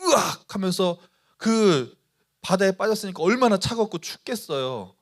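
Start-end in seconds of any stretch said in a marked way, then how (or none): 0.0-0.5 Peter's scream. Retold or imitated. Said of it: "빠졌어요".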